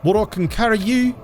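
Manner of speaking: high, put-on Kiwi accent as a hobbit; New Zealand accent. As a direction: play Sam in a broad New Zealand accent. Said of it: "Imagine if Lord of the Rings was entirely, uh, in the New Zealand accent".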